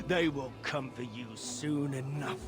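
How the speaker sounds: low, menacing prophecy; ominous warning. Acted. Madman warning questioner of nightmares, low and ominous. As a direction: drop low to threaten. Raving townsperson threatens the questioner.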